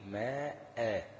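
ماء drawn out very long, ending on the alif with no hamza; stop on alif. Shown as a demonstration. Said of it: "لو هقف عليها هتبقى إيه". (وقف على ماء بألف العوض ممدودة)

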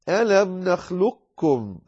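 slow "نخلقكم" as "نخلكّم", no qāf heard; "ألم نخلقكم" with the qāf gone into the kāf; second way of "نخلقكم", full merge; qāf fully merged. Shown as a demonstration. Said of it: "من غير ذكر للقاف تماما".

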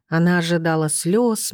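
ordinary delivery

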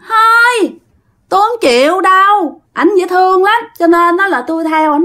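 high, whiny refusal; refusing ghost girl. Retold or imitated. Ghost girl refusing the medium's plea, in a high whiny voice. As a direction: whine it high like a stubborn girl.

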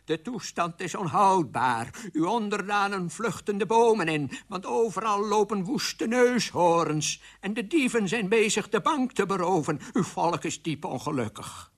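high-pitched, agitated official report; nervous minister. Acted. Minister reports chaos to the king, nervous and high. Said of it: "trillend van de zenuwen".

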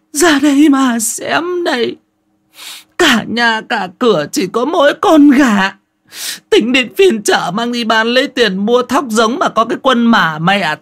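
high, loud shrieking lament; wailing old woman. Acted. Bà Bảy wailing to the neighbours over her stolen chicken. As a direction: wail it to the gathered neighbours. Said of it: "bà Bảy trâu tréo".

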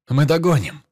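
loud, high shouted reply; shout from afar. Acted. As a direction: yell it up from below. Shouting back from below.

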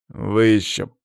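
slow, drawn-out menace; bitter, deliberate warning; seething threat. A narrator's reading. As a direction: squeeze out each word as a threat. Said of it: "процедил Павел".

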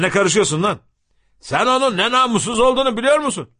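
rising, high-pitched confrontation; indignant crowd member. A narrator's reading. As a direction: tell a meddler off with mounting anger. Crowd turns on the intervener. (öfkeli çıkışma)

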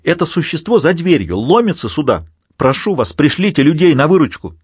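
panicked. Acted. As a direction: blurt it out in panic. Trapped Garcia begs for rescue.

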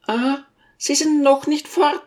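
high-pitched, stern question; stern lady. A narrator's reading. Lady finds the teacher still there, stern. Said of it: "fragte sie streng".

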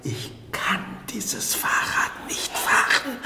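whispered, afraid to try; hushed, ashamed confession; scared whisper. Acted. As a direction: breathe it out in fear. Frightened man admits fear.

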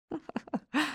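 short, soft chuckle; a quiet little laugh before answering; amused laugh. A narrator's reading. Saad answering a worried question about lost contact, soft laugh. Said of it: "رد سعد ضاحكًا".

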